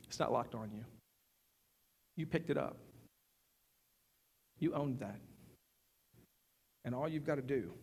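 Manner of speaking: soft, unhurried reassurance; gentle voice of God. Retold or imitated. God tells Nick he is free, softly and tenderly. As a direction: let it land quietly, like a release.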